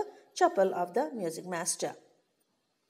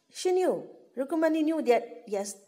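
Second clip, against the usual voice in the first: ordinary talk, nothing marked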